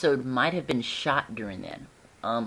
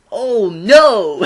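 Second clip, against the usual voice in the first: loud, high fake alarm; mock panic; 8 semitones higher, 15 dB louder than usual. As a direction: yell it like a hammed-up actor. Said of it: "Nathan's just like".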